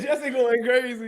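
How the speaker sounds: high, laughing echo of co-host; amused mimicking. Laughing, repeats co-host's line.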